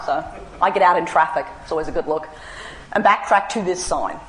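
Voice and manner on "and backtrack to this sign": normal talking voice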